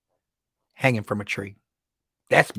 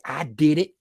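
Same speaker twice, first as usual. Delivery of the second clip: indignant backtalk; 5 semitones higher, 3 dB louder, faster than usual. Retold or imitated. Woman talking back to police.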